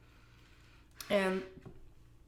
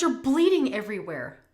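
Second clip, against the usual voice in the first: urgent husband; 4 semitones higher, 10 dB louder than usual. Retold or imitated. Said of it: "He goes".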